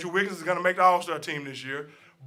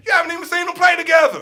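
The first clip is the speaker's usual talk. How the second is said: fast, loud, much higher rant; fired-up shout; 9 semitones higher, 9 dB louder, faster than usual. Real, not acted. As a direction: yell it in disbelief. Fired-up rant about Nets.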